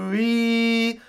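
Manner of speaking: high, held "tui" on one note; contrasting vowel demo. Shown as a demonstration. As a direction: sustain "tui" high, sliding from oo toward ee. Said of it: "as opposed to".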